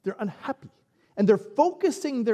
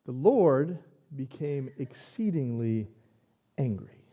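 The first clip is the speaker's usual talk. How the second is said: scripture reading; 4 semitones lower, slower than usual. Acted. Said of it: "And then we read this".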